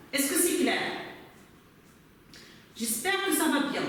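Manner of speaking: ordinary delivery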